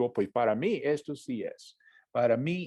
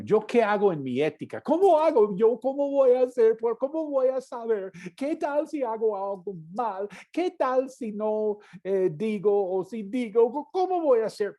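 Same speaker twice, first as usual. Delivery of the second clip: high, fretful run of worried questions; anxious believer; 8 semitones higher, 3 dB louder than usual. Retold or imitated. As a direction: voice the panicky doubter, pitched up. Preacher voices anxious believer. (creyente angustiado, voz aguda y nerviosa)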